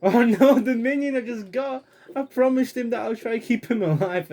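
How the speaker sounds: high, loud alarmed outburst; dismayed cry. Real, not acted. Dismay at sacrificing promised minion.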